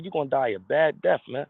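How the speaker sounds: ordinary delivery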